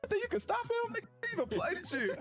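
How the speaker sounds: very high-pitched, incredulous retort; mock heated argument. Player retelling a locker room argument with his teammate, mock incredulous.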